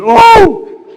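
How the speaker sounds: nurse's startled cry at the dropped hammer. Retold or imitated. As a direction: squeal it in shock. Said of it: "and she said".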